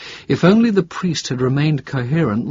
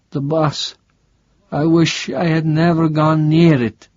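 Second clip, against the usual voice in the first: higher, wary voice of the sick priest; a frail priest, suddenly alert; startled priest asking a stranger; confused old priest; 6 semitones higher, 4 dB louder than usual. Acted. Priest remembers bus.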